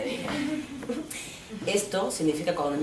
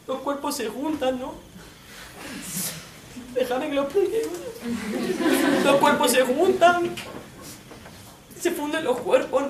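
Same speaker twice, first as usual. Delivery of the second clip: hammed-up macho poet; 7 semitones higher than usual. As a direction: overplay the boyfriend waxing poetic. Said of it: "Mi colega me lo explica". (novio macarra poniéndose poeta, exagerado y agudo)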